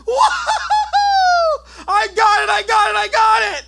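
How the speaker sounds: high, loud, laughing victory shouts; laughing screams. Retold or imitated. Narrator still screaming over the dead rat. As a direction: keep screaming, giddy with the kill. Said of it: "And I'm still screaming".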